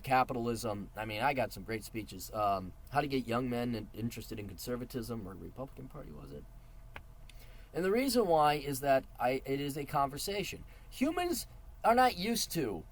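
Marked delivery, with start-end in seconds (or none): none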